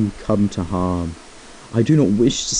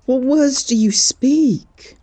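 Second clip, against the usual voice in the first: shocked disbelief; 12 semitones higher, 3 dB louder, slower than usual. Acted. Friend refuses to leave.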